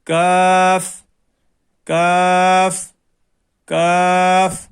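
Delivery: کاف drawn out light and thin; thin letter. Shown as a demonstration. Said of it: "اس کو باریک آواز میں".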